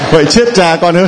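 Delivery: high, laughing mock dismay; laughing exclamation. Real, not acted. Priest laughing at the child's answer, amused mock dismay. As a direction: laugh through the joking complaint.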